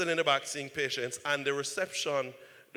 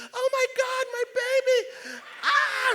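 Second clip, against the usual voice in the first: put-on very high, loud panicked wail, slow on my baby; panicking wife; 6 dB louder, slower than usual. Wife seeing her husband burned, panicked high shriek. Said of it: "she's panicking".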